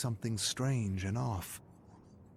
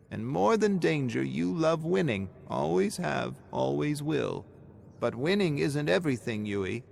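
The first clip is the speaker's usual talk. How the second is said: grandmother's voice; 7 semitones higher, 4 dB louder than usual. A narrator's reading. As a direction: talk like a grandmother gently lecturing her grandchild. Grandmother voiced lecturing Yui.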